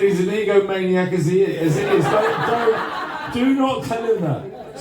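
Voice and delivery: mock lady voice. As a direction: playfully imitate her, giggling as you go. Host voices the lady's warning.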